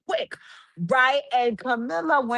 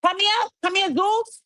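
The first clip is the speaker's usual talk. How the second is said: excited calling out; 10 semitones higher than usual. Real, not acted. Calling out to network.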